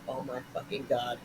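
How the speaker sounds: low, hushed disbelief; soft retold aghast aside; covered-mouth dismay. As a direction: say it under your breath into your hand. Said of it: "cover my face, you know, my mouth".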